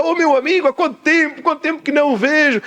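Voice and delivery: high, delighted hello to a friend; animated high-pitched welcome; animated greeting. Acted. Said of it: "animados ... o pastor fala alto naturalmente".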